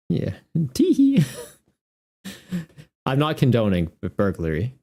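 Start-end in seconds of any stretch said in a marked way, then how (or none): 0.7-1.2 high put-on tee-hee; mock giggle. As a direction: giggle like a cheeky kid. Mock giggle after joke.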